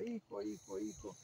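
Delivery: very low, soft mock call; understated mother's cry dropped deep and quiet. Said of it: "la mamá grita". (grito fingido de mamá, grave y bajito)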